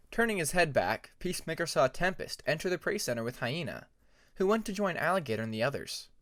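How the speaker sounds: plain reading voice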